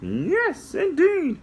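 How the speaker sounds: high squeaky puppet, slow; a little puppet explaining to kids; high cartoon helper voice. Puppet answers.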